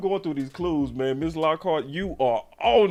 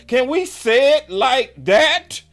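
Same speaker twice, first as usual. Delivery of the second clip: higher, louder, drawn-out challenge; slower emphatic repeat; 9 semitones higher, 9 dB louder, slower than usual. Real, not acted. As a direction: lean harder on it. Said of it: "So can we say it like this?".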